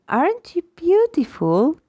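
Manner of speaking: high, rising like a question. Shown as a demonstration. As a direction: ask it high, baby-talk style. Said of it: "was very high too".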